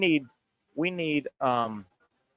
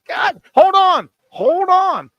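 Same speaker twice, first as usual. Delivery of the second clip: much higher, louder panicked shouting; frantic yelling; 7 dB louder than usual. Real, not acted. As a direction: stop everything, shout him down. Frantic over a trivia answer.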